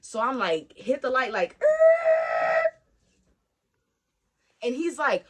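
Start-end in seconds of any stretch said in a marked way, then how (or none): 1.6-2.6 loud vocal tyre screech; screech of brakes. Retold or imitated. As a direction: imitate a car skidding to a halt. Imitates brakes at the light.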